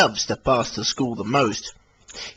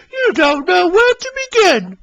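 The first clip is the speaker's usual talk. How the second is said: Daisy voice; 6 dB louder than usual. Acted. Daisy teasing.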